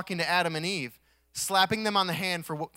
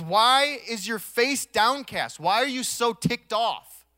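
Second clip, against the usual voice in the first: high, loud, slow challenge; preacher voicing God's emphatic questioning; God questioning Cain; 7 semitones higher, 6 dB louder, slower than usual. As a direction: draw out a forceful divine question. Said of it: "And God says to him".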